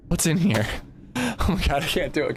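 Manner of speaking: usual speaking voice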